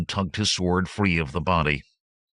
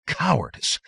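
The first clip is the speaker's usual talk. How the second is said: indignant cry; 12 semitones higher than usual. Acted. Lieutenant outraged at insult.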